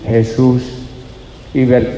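ordinary talk

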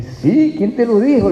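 high, playful retort; a light, high mock-surprised question; mock surprise. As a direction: tease her with surprise. Retold playful reply.

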